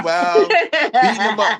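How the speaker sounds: laughing while telling the fight. Real, not acted. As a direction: chuckle through the words.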